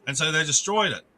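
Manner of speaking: ordinary talk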